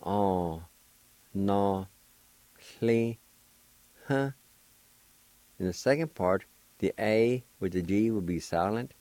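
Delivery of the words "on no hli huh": "On, no, hli, huh" slowly, with a breathy "hli"; Cherokee syllables. Shown as a demonstration. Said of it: "First part is pronounced like this".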